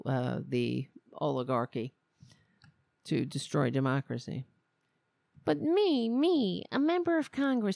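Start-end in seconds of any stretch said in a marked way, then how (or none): 5.4-7.8 high mimicked indignation; higher, hammed-up wounded pride; mock self-importance. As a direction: play the affronted politician, mockingly. Mimicking the aggrieved congresswoman.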